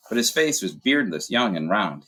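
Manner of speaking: usual speaking voice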